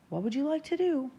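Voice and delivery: ringing, high-pitched question; shout. A narrator's reading. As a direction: demand an answer from the crowd. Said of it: "Walt shouts".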